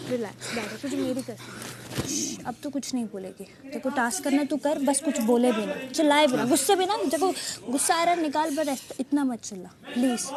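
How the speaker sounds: low, hushed calming voice; soothing plea. Real, not acted. Friend begging angry housemate to stop shouting, low and soothing. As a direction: talk her down softly and earnestly.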